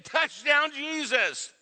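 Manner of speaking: high, loud cheering for God; fan cheer. Acted. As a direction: cheer it like a touchdown. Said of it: "it's just saying".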